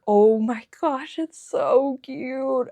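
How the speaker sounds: loud, high, drawn-out gush; slow, emphatic fawning exclamation; gushing over cuteness. Retold or imitated. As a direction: stretch it out, bursting with adoration. Retelling reaction to Psyduck.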